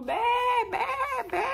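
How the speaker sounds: high repeated bleats; sheep bleating. Acted. All the sheep crying out, bleating. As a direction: cry out like frightened sheep. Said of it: "también así gritando".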